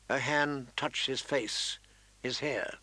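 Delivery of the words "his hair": ordinary delivery, nothing marked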